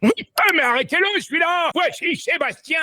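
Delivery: high cartoon voice presenting a guest; cartoon host voice. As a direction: announce him like a squeaky toon host. Playing a cartoon character.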